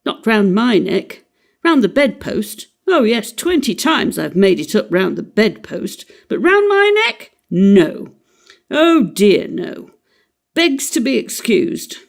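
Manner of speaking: biting, mocking sarcasm; dangerously sarcastic. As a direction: sneer at the stubborn tie. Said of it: "He became dangerously sarcastic".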